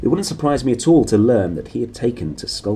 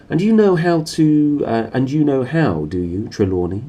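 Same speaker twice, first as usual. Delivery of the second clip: higher and louder, a mocking sneer; 6 semitones higher, 6 dB louder than usual. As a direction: needle him with scorn. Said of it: "said the dean sneeringly".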